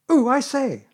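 high, loud excited exclamation; Piglet. A narrator's reading. Little friend jumping about at the accident, excited. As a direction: burst out as if jumping up and down. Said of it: "jumping up and down and making".

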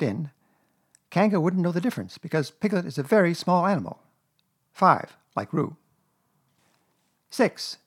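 emphatic list reading, numbers set apart; Rabbit reading proudly. A narrator's reading. Rabbit reading his plan aloud to Pooh and Piglet, proud. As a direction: read out a plan importantly, point by point. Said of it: "Rabbit read this out proudly".

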